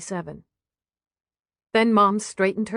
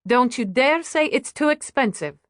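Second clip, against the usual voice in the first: higher, mildly insistent hostess; mock scolding; 6 semitones higher than usual. Waitress insists on treating her guests, mock scolding. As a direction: forbid any objection, lifted and generous.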